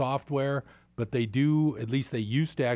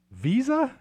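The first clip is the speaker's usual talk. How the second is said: much higher and louder mocking question; incredulous; 12 semitones higher, 4 dB louder than usual. Real, not acted. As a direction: scoff at the name. Listing non-industrial Dow stocks.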